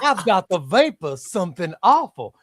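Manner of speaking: hammed-up Southern belle; Southern accent. Shown as a demonstration. Demonstrating the Southern accent for the co-host, hammed-up belle. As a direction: play a Southern lady with the vapors. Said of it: "that's the Southern accent".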